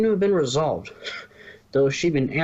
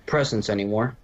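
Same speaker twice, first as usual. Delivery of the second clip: ordinary delivery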